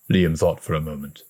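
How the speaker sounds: ordinary delivery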